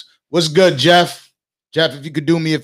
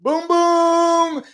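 hyped boom; 14 semitones higher than usual. Real, not acted. Hype reveal of booty.

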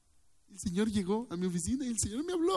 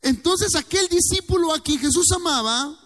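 preaching at full force; 9 semitones higher, 7 dB louder than usual. Real, not acted.

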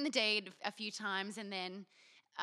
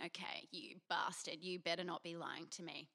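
hushed suspicious whisper; soft, low muttered threat; 4 semitones lower, 5 dB quieter than usual. Retold or imitated. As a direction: whisper the thought under your breath. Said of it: "I'm thinking".

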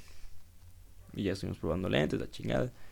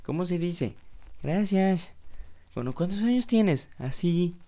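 put-on much higher, sing-song baby-talk adult; talking to a toddler; 8 semitones higher, 4 dB louder than usual. Grown-up coaxing a little kid to answer, high sing-song. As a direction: coo at a small child like a proud grown-up. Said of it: "Es como cuando traes a los niños chiquitos".

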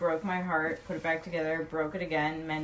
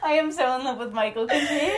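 laughing gush; 6 semitones higher, faster than usual. Real, not acted. Gushing through laughter.

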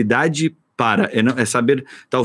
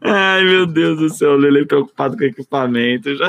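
laughing; 5 semitones higher than usual. Real, not acted. Laughing at Lelê's worry.

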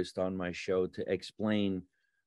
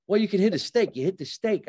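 retold goading voice; 8 semitones higher, 4 dB louder, faster than usual. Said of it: "I go".

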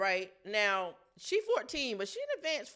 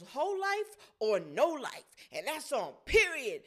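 slow, every word weighted; deliberate emphasis; 2 semitones higher, 3 dB louder, slower than usual. Retold or imitated. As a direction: lay down each word like a rule. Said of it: "She goes".

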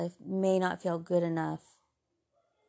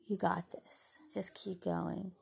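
hushed whisper, much softer; whispered encouragement; 9 dB quieter, slower than usual. Retold or imitated. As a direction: breathe the words gently like a secret pep talk. Friends encourage her, hushed.

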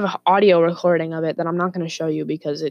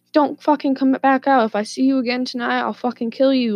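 higher, mildly heated warning; retold threat with raised pitch; imitated angry threat; 6 semitones higher than usual. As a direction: imitate an uncle warning someone off. Uncle threatens the neighbour.

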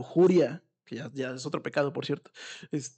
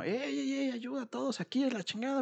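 mild mock yell; 10 semitones higher, 4 dB quieter than usual. Grandmother calling the whole house for help, mild mock yell. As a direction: pretend to shout for everyone. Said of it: "empieza a gritar así como".

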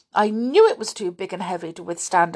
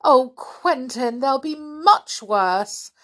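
higher, louder and slow, on the edge of crying; near to tears; 7 semitones higher, 7 dB louder, much slower than usual. Acted. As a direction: plead as if about to cry. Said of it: "very near to tears".

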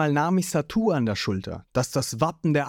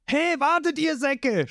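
Zorro shouting after them; 12 semitones higher than usual. A narrator's reading. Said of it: "schreit Zorro hinterher".

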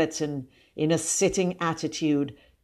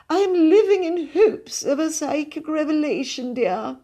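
put-on high-pitched, affected elderly cousin; gushing spiritualist lady; 11 semitones higher, 3 dB louder than usual. A narrator's reading. Cousin confides in the boy's mother, gushing. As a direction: effuse in a high, theatrical lady's voice.

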